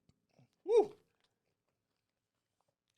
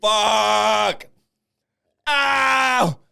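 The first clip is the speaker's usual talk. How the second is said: loud, high cries of pain; pained shout; 9 semitones higher, 14 dB louder, much slower than usual. Real, not acted. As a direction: yell out from the burn. Pain from the hot food.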